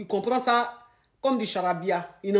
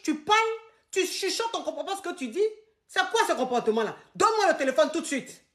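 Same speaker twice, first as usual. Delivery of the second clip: high-pitched, outraged scolding; acted tirade demanding the phone; violent jealous husband; 7 semitones higher than usual. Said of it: "Pourquoi tu es violent comme ça?".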